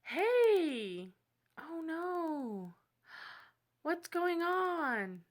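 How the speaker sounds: high, drawn-out fake concern; reenacted phone call. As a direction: act out a concerned phone greeting. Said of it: "I'm gonna reenact it for you".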